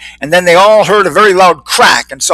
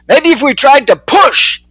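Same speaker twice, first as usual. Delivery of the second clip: yelled; 9 semitones higher than usual. A narrator's reading. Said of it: "One fly yelled".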